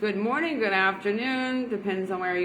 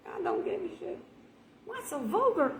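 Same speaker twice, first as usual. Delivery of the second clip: mock shocked churchgoer; 9 semitones higher, 8 dB quieter than usual. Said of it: "be like".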